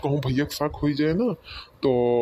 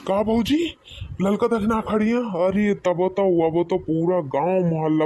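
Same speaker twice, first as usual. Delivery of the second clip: shocked; 5 semitones higher than usual. Acted. Brother-in-law panicking on hearing they were seen, high and shocked. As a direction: react in high panic, disbelieving.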